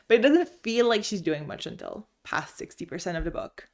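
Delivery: ordinary talk, nothing marked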